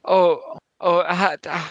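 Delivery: flustered, hammed-up German accent; bad German accent. Immigrant disputing claim of falsified papers, bad German accent. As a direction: stammer and protest in a clumsy German accent. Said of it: "the worst German accent ever".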